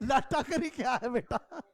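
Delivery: amused, words broken up by laughter; real chuckling, slow and tickled; laughing. Real, not acted. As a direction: laugh through a drawn-out, incredulous question. Amused by student's wording about lagging.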